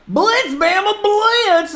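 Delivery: very high, loud mock Southern football chant; hammed-up Southern accent. Imitating an Alabama football fan, hammed-up Southern accent. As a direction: holler it like a cartoon Alabama fan, Southern drawl. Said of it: "is that a good accent for the South?".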